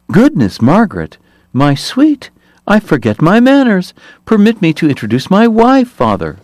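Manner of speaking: oily politician in a piping voice; smooth high voice. Acted. Hoover's high voice continues.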